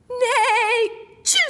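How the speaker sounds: whinny high, burst into a sneeze; sneezing horse. A narrator's reading. Narrator as the horse, loud sneezing whinny. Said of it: "sneezed horse".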